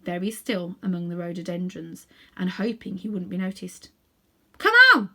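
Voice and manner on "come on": high, loud excited cry; eager call. A narrator's reading. Several animals urge each other on, loud and excited. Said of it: "said several voices".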